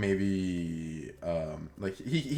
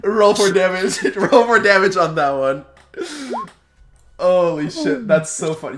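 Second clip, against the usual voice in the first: higher and louder, laughing through the words; 9 semitones higher, 11 dB louder than usual. Real, not acted. Game master laughing as he asks for damage. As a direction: call for damage while cracking up.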